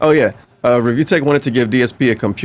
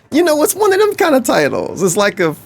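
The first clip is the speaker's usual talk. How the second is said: squeaky high voice; 8 semitones higher than usual. Real, not acted. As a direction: jump way higher, excited. Excited high outburst.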